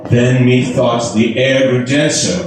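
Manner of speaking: ordinary delivery, nothing marked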